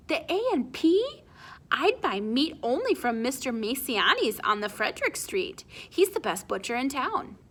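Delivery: high, scandalized mother; shocked Ma. Acted. Ma appalled that Spyro mentions that store, high and shocked. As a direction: gasp out the store name in horror.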